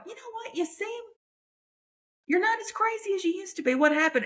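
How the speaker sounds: mock amazed friend. Comic imitating herself noticing a changed friend, high mock surprise. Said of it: "I'm gonna, probably gonna go".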